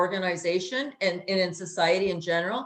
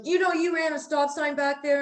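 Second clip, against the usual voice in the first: raised-pitch accusing officer; retold edgy cop; 7 semitones higher than usual. Officer accusing a driver over a stop sign, mock agitated voice. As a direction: imitate a cop on the edge. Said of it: "on the edge".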